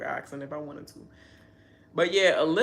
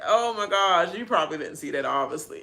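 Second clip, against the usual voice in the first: ordinary talk, nothing marked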